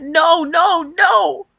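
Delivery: high, loud crying no; sobbing scream. Retold or imitated. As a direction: cry out no, no, no in despair. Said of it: "hitting myself in my head".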